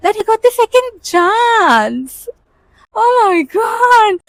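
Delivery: high, drawn-out cry of disbelief; shocked disbelief. Real, not acted. Telling of her rival's second chance, drawn-out shocked cry. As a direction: stretch it out, outraged and amazed.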